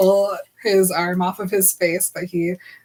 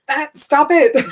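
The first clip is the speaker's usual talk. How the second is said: high, loud comic telling-off; exasperated mock scolding; 6 semitones higher, 6 dB louder, slower than usual. Bella telling Edward off, loud mock exasperation. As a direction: cut him off in playful exasperation.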